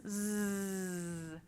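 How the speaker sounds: Z as a soft, held buzzing z; z sound. Shown as a demonstration. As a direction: buzz the z softly and hold it. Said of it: "Everybody say that sound".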